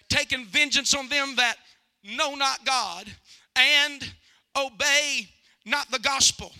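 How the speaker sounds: loud, fervent revival preaching; preaching at full force. Acted. Evangelist urging the congregation to repent, preaching loudly. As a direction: drive the altar call home.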